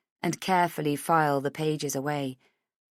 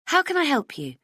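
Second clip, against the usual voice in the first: higher, bright and friendly; bright friendly hello; 10 semitones higher, 3 dB louder than usual. A narrator's reading. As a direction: answer the phone sunnily. Heather asks politely.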